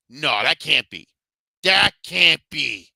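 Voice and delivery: dramatized refusal to believe it; stunned disbelief. Retold or imitated. Reliving shocked disbelief at game scene.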